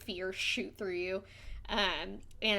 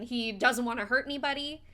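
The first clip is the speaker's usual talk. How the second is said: usual speaking voice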